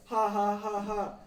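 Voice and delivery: loud, high "ha ha" imitation; hammed-up haw-haw of rowdy kids; mock group laughter. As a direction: fake a loud spoken laugh. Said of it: "redneck, like, trash".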